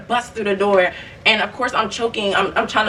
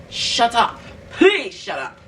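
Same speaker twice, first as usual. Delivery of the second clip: irritated; 3 semitones higher, much slower than usual. Retold or imitated. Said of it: "five seconds from saying".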